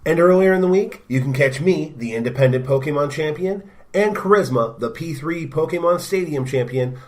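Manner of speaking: bright promo host voice; promo announcer. Acted. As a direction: hype up the network shows. Network promo read.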